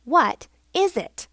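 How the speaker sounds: a touch of exasperation; testy. A narrator's reading. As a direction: demand it with drawn-out impatience. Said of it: "replied Grandfather Frog testily".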